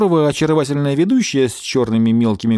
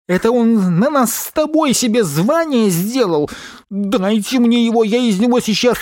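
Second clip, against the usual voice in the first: outraged rant; 10 semitones higher than usual. Acted. Porcupine raging at the TV.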